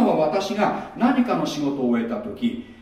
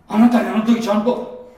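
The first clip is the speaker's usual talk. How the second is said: mock self-righteous reminder; 5 semitones higher, 4 dB louder than usual. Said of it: "っていうのが人の技".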